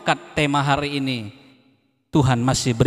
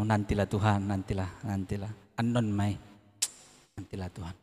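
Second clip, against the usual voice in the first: fading, much softer inner murmur; 5 semitones lower, 10 dB quieter than usual. Retold or imitated. Preacher voices a believer stalling God inwardly, quiet and fading. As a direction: say it under your breath to yourself. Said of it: "bilang dalam hatimu".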